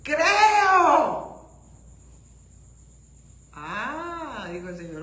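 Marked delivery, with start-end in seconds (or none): 0.0-1.5 loud, higher cry of faith; shouted cry. Retold or imitated. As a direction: cry out as the desperate father. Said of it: "gritó y dijo".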